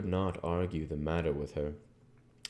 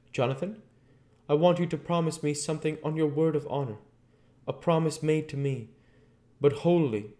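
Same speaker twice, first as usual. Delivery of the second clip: put-on higher, urgent young wife's voice; Mina; 10 semitones higher, 4 dB louder than usual. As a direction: plead with him earnestly for the promise. Mina begs Jonathan's promise.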